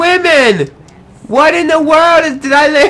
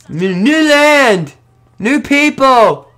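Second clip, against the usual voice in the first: drawn-out announcement; much slower than usual. Acted. Arrives on new shore.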